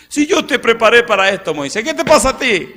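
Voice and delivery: God scolding. Acted.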